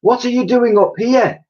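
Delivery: high loud call; girl calling to the mammoth, high and loud. A narrator's reading. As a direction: shout it across the roof.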